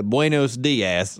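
slow, low Spanish with a Southern accent. Shown as a demonstration. Showing the classic Southern person speaking Spanish, Southern accent. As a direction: Southerner carefully mangling Spanish. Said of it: "it's that southern, that southern person".

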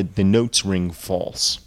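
ordinary delivery, nothing marked

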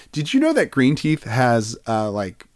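ordinary delivery

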